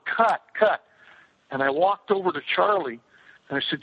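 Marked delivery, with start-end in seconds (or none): 0.1-0.8 higher, retold director's call to stop; mock holler. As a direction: imitate hollering to halt filming. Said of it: "so I hollered".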